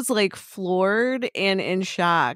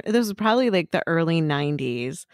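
normal talking voice

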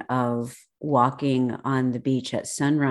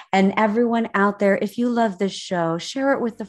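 podcast outro; 5 semitones higher than usual. Acted. Podcast sign-off.

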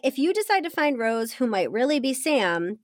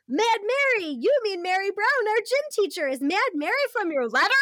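higher, urgent questioning; excited cry; 8 semitones higher than usual. Acted. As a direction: blurt it out in alarm. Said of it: "you cry".

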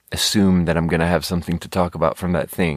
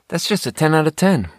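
emphatic; 7 semitones higher than usual. Real, not acted. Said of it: "I don't know how else to say it".

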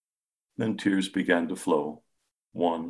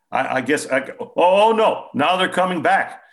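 much higher and louder, breaking alarm; alarmed surprise; 11 semitones higher, 8 dB louder than usual. A narrator's reading. Patient feeling the currents return, alarmed and higher. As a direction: panic as it comes back. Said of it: "He looked surprised".

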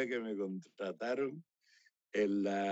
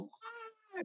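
high falling wail, very soft; mock child wail; 13 dB quieter than usual. The crying boy in the front row, as a mock soft wail. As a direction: imitate a toddler bursting into tears. Imitating the child bursting into tears.

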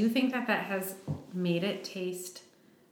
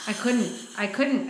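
ordinary talk, nothing marked

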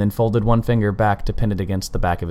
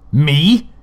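shocked shout, much higher and louder; 8 semitones higher, 8 dB louder than usual. A narrator's reading. Colin shocked he is the target, shouting. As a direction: blurt it out in horror. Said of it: "he shouted".

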